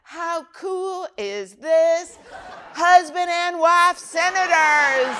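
high, loud, very slow gushing with a laugh; excited flight attendant. Retold or imitated. Senator imitating flight attendant thrilled about couple, high loud gush. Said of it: "Flight attendant".

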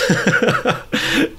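high, breathy burst of laughter; rueful laugh. Real, not acted. Host admitting he was the worst, rueful laugh. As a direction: laugh helplessly at yourself. Laughs at his own past behaviour.

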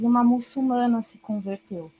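normal talking voice

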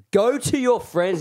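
high, loud mock dismissal; retold command. Zach ordering the lingering Irishman away, retold loud command. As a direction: send him off loudly, acting it out.

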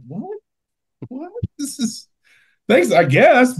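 high, bewildered squeak; baffled. Retold or imitated. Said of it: "And I'm like".

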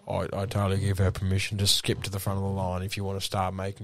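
low, measured company spiel; corporate spokesperson. Acted. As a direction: recite the official policy.

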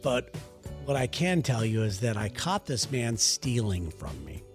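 normal talking voice

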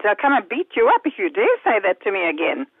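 ordinary talk, nothing marked

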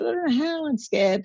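high-pitched, mock-frightened wail; retold panic. Telling her friend she can't bake it, in a high, mock-scared voice. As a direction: squeak it out like a scared cook. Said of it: "I rang her in a panic".

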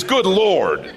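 loud, high exclamation of frustration; exasperated. Acted. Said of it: "What I say is".

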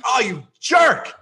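exasperated half-shout at a bad shot; retold yell. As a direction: yell it at yourself mid-swing. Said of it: "Just yell".

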